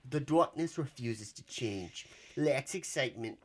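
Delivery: reading a review in a hammed-up New York accent. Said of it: "Do a New York accent".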